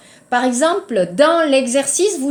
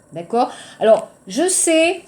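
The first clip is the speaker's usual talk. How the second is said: ordinary talk, nothing marked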